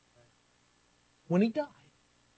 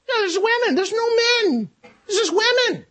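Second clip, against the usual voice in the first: mock whine; 13 semitones higher, 4 dB louder than usual. Paul voiced confused in Philippi.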